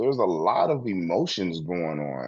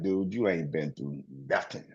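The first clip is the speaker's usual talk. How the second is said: dismissive inner voice; 4 semitones lower, 8 dB quieter than usual. Retold or imitated. Mocking own inner voice.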